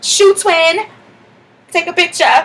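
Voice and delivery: very high, loud, gushing; overly sweet shoe-twin squeal; fake-sweet squeal. Narrator to the angry girl, fake friendly squeal. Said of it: "I'm like".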